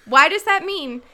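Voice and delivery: loud, exasperated protest; indignant rant. Real, not acted. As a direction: demand a reason, raising your voice. Indignant rant demanding an answer.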